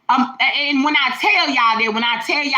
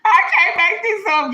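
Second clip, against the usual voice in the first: laughing; 10 semitones higher than usual. Real, not acted. Laughing after God voice.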